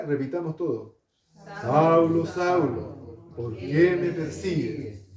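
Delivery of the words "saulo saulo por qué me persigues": loud call, then slow and solemn; voice from heaven. Acted. Heavenly voice calls Saulo on the road, loud then solemn. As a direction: call his name out from above, then slow down. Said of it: "oyó una voz que le decía".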